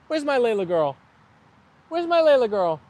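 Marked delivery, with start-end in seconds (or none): 1.9-2.8 higher sing-song voice for the dog; pet talk. Real, not acted. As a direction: coo it to your dog, pitched up.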